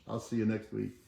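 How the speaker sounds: normal talking voice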